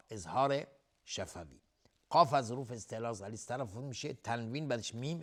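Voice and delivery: usual speaking voice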